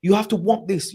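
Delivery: high-pitched, insistent coach imitation; Pep Guardiola. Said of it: "he talks like that".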